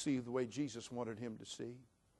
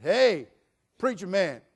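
loud, high call to the preacher; jailed grandfather; 5 semitones higher, 12 dB louder, slower than usual. Retold or imitated. Grandfather hailing the preacher in jail, calling out. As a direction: mimic a grandfather calling out loudly. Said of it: "the grandfather said".

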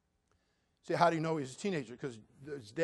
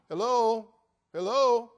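calling out; 6 semitones higher, 7 dB louder, much slower than usual. Retold or imitated. Peter knocking at the door.

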